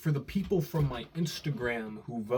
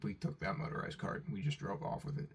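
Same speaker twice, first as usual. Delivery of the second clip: whispered; 2 semitones lower, 8 dB quieter than usual. Real, not acted. Unvoiced confession.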